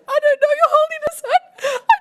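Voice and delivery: high-pitched, rushed comic blubbering; mock crying. Producer to His Holiness, hammed-up crying. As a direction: wail it through imitated sobs. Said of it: "and I kept crying".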